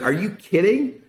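thrilled disbelief. Retold or imitated. Thrilled at the offer.

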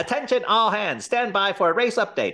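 high, excited race announcer voice; race caller. Retold or imitated. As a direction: announce the race like a radio play. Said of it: "it was very much like that and almost like a radio play".

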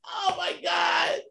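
high, shrieking laugh-cry of shock; laughing disbelief. Real, not acted. Hearing his friend faked the server's reply, shrieking with laughter. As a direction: break up completely as the prank lands.

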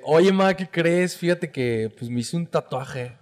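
slow, careful mock confession. Son confessing a tattoo to mom, slow and careful. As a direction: break it to mom slowly. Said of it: "Y ya le dije".

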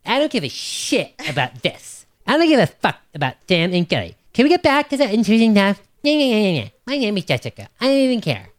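high, mocking imitation of a co-host; Jessica. Co-host teasing his partner with a high mocking impression. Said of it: "how Jessica's just like".

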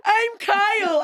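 high, cheesy improv-character voice; mock improv intro. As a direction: ham up a goofy stage intro, pitched high. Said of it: "everybody opened with".

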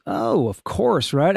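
usual speaking voice

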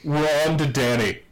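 ordinary delivery

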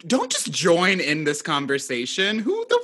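high-pitched incredulous outrage; mock indignation. Host retelling their reaction to a stranger joining in, indignant. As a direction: act out being affronted by the intrusion.

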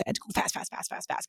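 breathless hurried whisper of fast, fast; rushed whisper. Retold or imitated. Retelling her frantic rush to investors, hurried whisper. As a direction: whisper it like racing against the clock.

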